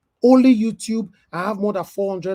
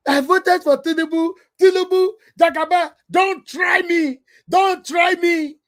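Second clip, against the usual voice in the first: mocking supporter; 12 semitones higher, 7 dB louder than usual. Scene: vlogger mimicking a bike-riding Tinubu supporter, mock high yell. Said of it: "mocking Tinubu supporter".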